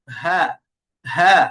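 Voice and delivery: breathed "হা" with no force; relaxed h, air running through "হা"; soft, effortless ha. Shown as a demonstration. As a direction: let "হা" flow out easily on the breath. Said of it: "হা উচ্চারণ করার সময় আমাকে শক্তি দেওয়া লাগে না".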